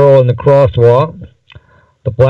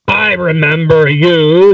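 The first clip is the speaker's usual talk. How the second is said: howled; 4 semitones higher than usual. A narrator's reading. Man at M in kitchen, a mild drawn howl. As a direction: let it stretch out as a mild howl. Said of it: "the man howled".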